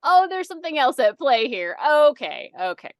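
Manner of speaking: mock realization settling into okay; dawning realization. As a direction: voice the coworker catching on. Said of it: "my coworker started going".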